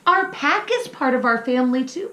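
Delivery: put-on high, eager child voice; little pup voice. Little pup asking his mama, high and curious.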